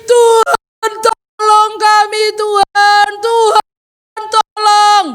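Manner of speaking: high, loud, drawn-out acted wail; pleading cry. Israelites beg God for help, crying out. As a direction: cry out for help, stretching each word. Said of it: "mereka nangis".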